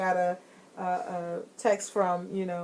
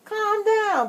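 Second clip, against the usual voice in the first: calm down; 13 semitones higher, 4 dB louder than usual. Retold or imitated. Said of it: "I'll be like".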